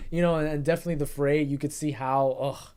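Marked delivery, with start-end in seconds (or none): none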